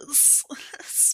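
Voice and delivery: breathy stammered whisper; a whispered stuttering start. Real, not acted. Player whispers a stammer about the minty smell.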